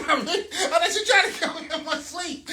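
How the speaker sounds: high-pitched, giggly mock panic; laughing mock alarm. Husband joking wife is smothering him, laughing alarm. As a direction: laugh through a pretend fear for your life.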